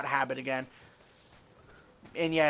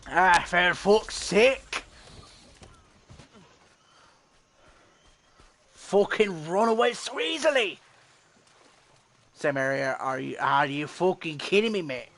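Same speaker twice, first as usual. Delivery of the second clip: frustrated outburst in a broad Australian accent; slower than usual. Real, not acted. Gamer raging at a fleeing monster in an Australian accent. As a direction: swear like an Aussie when the monster runs. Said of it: "tend to break out into this when things don't go my way".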